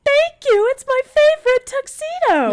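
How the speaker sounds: very high, loud squeaky mouse; exaggerated cartoon mouse imitation; Mickey Mouse. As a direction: pipe up proudly in cartoon mouse falsetto. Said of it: "doing the Mickey Mouse voice".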